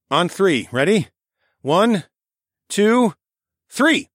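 rising shouted count to three; counting off loud. Acted. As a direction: call the lift count, building to a shout. Counting off a heavy lift.

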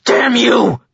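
anguished outburst building to high, tearful fury; grief-stricken fury rising in pitch; boiling rage. A narrator's reading. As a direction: rage at him, breaking into a cry. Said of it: "His rage boiling over".

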